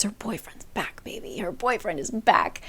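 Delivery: secretive, giddy whisper; gushing under the breath; excited whisper. Retold or imitated. Giddy aside about boyfriend back.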